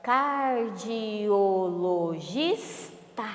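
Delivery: CARDIOLOGISTA drawn out as car-di-o-lo-gis-ta; syllable by syllable. Shown as a demonstration. Teacher has pupils count syllables, "Cardiologista" split very slowly. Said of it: "tem quantas sílabas?".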